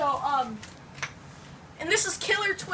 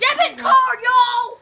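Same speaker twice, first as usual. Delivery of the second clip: loud, high excited yell; excited shout; 8 semitones higher, 10 dB louder, slower than usual. Real, not acted. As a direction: shout it out as the dart fires. Yelling as the dart fires.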